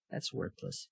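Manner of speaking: whispered under the breath: that's worthless; a dismissive whisper, almost unheard. Real, not acted. Disappointed aside about Minnie Driver.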